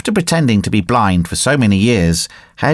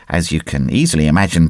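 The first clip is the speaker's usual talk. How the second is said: plain reading voice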